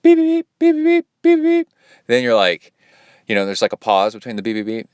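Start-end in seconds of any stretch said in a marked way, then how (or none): 0.0-1.7 high, louder beeping, like a watch going off; imitating watch beeps. Feet hitting the wall, mimicking a loud watch beep. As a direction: chirp the beeps out like a timer.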